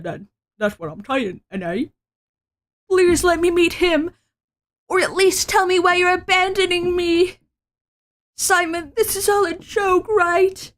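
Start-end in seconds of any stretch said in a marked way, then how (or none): 2.9-10.7 higher pitched begging, pushing the words out; desperate pleading. Acted. The lady begging Simon to explain, higher and urgent. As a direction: plead with him, voice up high.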